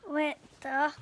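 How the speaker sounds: dramatic loud narration; booming storytelling. Acted. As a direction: announce it big to the listeners. Dramatic read-aloud emphasis.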